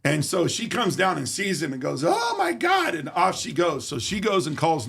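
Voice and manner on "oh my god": very high shocked shriek; shrieking wife. Retold or imitated. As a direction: scream it in panic at the stranger. Wife sees intruder.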